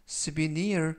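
souvenir with the stress on the last syllable, on the I; stress on the end. Shown as a demonstration. Teacher models the stress on souvenir's I, slow and deliberate. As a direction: lean on the end of souvenir, slowly. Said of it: "I에 강세가 있습니다".

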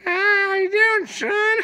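very high, piercing mock granny voice; hammed-up falsetto old lady; grandma impression. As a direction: squeal it like a caricature grandmother. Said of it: "Grandma called me and she was like".

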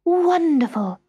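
high, loud exclamation; thrilled polar bear; delighted cry. A narrator's reading. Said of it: "cried Polar Bear".

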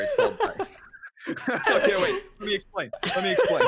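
laughing while talking; words breaking into laughter. Real, not acted. Caught out, laughing mid-explanation.

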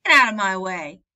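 put-on loud, bossy child's shout. A narrator's reading. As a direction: yell it at the herd boy to scatter him. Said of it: "shouted Teddy".

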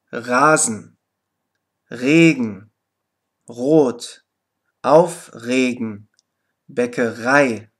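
low, measured word list with voiced throaty R in "Rasen", "Regen", "Rot"; syllable-initial R. Shown as a demonstration. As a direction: model each word separately, R sounded in the throat. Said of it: "am Silbenanfang R ausgesprochen".

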